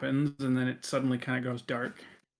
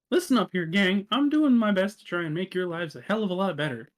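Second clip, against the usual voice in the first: high, quick scolding voice; exasperated Death; 6 semitones higher, 5 dB louder than usual. Acted. Death telling off the gang he's helping, high exasperated voice. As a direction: lecture the gang in a pitched-up voice.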